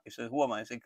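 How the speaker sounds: normal talking voice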